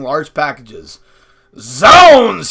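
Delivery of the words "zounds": loud, high, drawn-out exclamation; astonished cry. A narrator's reading. Father sees an army of people with packages, crying out. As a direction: cry it out in big surprise. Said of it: "he cried".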